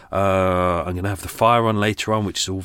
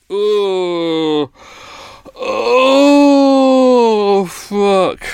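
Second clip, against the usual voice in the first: startled cry; 11 semitones higher, 8 dB louder, much slower than usual. Real, not acted. Startled by bird noise.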